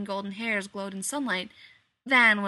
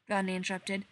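usual speaking voice

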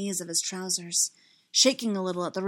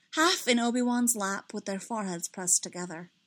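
plain reading voice

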